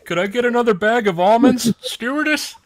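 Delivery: high nasal-free pleading call; whiny passenger voice. Pretend. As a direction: call the stewardess like a needy passenger. Mock passenger calling stewardess.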